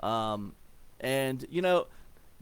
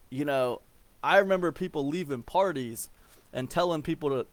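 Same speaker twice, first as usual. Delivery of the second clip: normal talking voice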